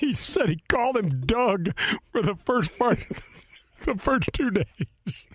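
breaking up while telling the story; real laughter. Real, not acted. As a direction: laugh through the words, losing the voice.

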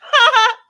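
sudden high loud cackle; real hearty laugh; loud burst of laughter. Real, not acted. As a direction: crack up loudly at the comment.

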